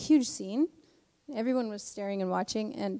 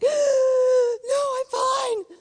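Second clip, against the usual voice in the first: hammed-up wailing, very high and loud; gasp, then squeal it out as a tearful meltdown; exaggerated imitation of a sobbing woman; mock crying; 11 dB louder, much slower than usual. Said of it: "Like, crying so hard".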